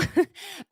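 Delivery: a high burst of real laughter; giggling at herself. Real, not acted. Laughs at never travelling.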